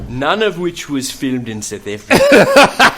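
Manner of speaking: loud, slow mock South African accent; slow, booming South African impression. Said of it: "See, another South African".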